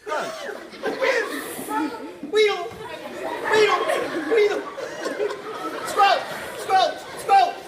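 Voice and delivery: high, shouted onomatopoeia words; sound poetry. Acted. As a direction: shout each sound word, high and punchy. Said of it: "creating an onomatopoeia".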